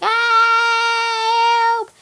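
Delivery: long, high, loud "ah" scream; mock-panicked wail, held long, then help. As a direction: shriek as if swept off by wind. Soldiers blown away by winds.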